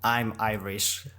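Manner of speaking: slow, tentative go at Irish; attempted Irish accent. Shown as a demonstration. Co-host taking his turn after her demo, attempted Irish accent. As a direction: copy the Irish accent slowly. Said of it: "and then you do it".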